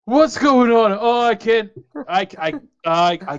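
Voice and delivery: high, loud, stammering alarm; startled goblin crying out in confusion; panicked. Acted. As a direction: wake up in a loud panic, tripping over words. Goblin wakes at sea, panicking.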